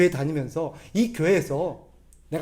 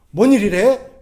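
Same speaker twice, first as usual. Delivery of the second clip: louder, gruff grumble of pleased surprise; mock gruff husband; 3 semitones higher, 10 dB louder, slower than usual. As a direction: grumble it like a husband hiding his joy. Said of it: "괜히 막 틱틱해도". (속으로 좋으면서 틱틱대는 남편 말투)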